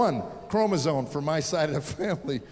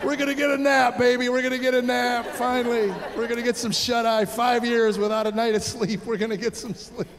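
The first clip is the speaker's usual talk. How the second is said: higher, giddy parent's chant; gleeful celebrating; 5 semitones higher than usual. Retold or imitated. Relieved parents skipping from kindergarten drop-off, gleeful. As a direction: chant it like you are skipping along.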